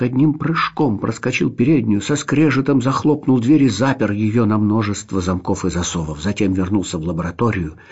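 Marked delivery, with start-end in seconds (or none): none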